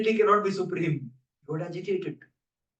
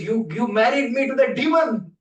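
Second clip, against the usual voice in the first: higher, louder tearful complaint; upset goddess; 7 semitones higher, 5 dB louder than usual. Acted. Nidra Devi complains to Brahma, higher and louder. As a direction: complain as if wronged.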